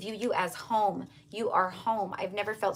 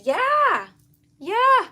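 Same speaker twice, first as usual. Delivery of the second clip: much higher, loud excited yeah; excited cheer; 10 dB louder than usual. Real, not acted. Cheering a viewer's vacation news, high and loud. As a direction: whoop with real excitement.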